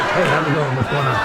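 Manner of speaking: put-on low-pitched tough kid voice; toughest kid's voice. Sister's kid born amid cigarette smoke, low tough voice. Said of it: "he talks like this".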